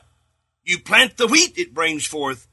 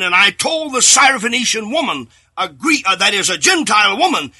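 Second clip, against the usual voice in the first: louder, rising preacher's proclamation; fervent preaching; 3 semitones higher, 3 dB louder than usual. Acted. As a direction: proclaim it to the congregation with full force. Preacher voicing Jesus' words.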